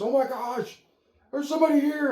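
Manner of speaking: high, loud, frantic dog alarm; excited dog voice. Retold or imitated. Dog voiced panicking at the mailman, high and loud.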